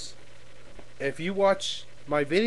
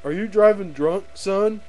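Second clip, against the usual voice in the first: bullying cop; 4 semitones higher than usual. Acted. Cop asking a driver for the license, pushy. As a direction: put on a pushy traffic officer. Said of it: "one of those big prick cops".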